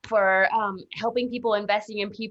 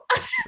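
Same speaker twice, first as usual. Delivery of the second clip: burst of laughter. Real, not acted. Delighted at picking the biggest instructor.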